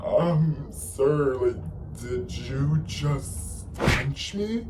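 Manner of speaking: high, drawn-out stunned question; retold shock. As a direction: act out slow, halting disbelief. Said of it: "I'm, like, shocked".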